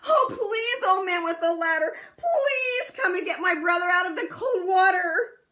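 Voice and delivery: high, loud tearful begging; pleading cry. Acted. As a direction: beg the old man, crying out high and loud. Chang begging the old man.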